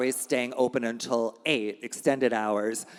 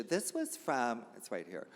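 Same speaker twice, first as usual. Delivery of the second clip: normal talking voice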